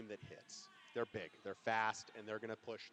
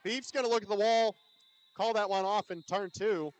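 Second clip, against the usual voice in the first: loud, high, punchy action call; live play-by-play; 8 semitones higher, 10 dB louder than usual. Real, not acted. Announcer calls Beef at the wall, excited play-by-play. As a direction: call it out over the crowd.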